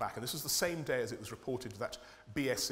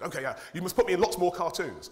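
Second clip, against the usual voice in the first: eager plea; 6 semitones higher, 8 dB louder than usual. Retold or imitated. MP's phone call retold.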